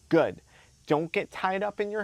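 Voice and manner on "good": "Good" punched loud and falling; emphatic "Good". Acted. Coaching listeners to reiterate to themselves, emphatic "Good". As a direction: say "Good" as a hard one-word verdict. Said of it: "emphasis on the word good".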